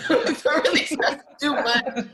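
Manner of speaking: loud laughter through the words; laughing. Real, not acted. Repeating Dinah's teasing about homeschoolers, laughing.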